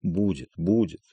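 soft, hushed comfort with a touch of tears; gently tearful consoling; soft through tears. A narrator's reading. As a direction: murmur it tenderly to soothe her. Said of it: "Трофимов мягко сквозь слёзы".

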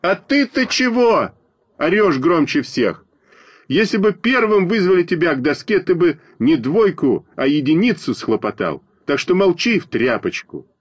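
high-pitched indignant retort; yelling at classmate. A narrator's reading. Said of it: "закричал я на Веньку Смирнова".

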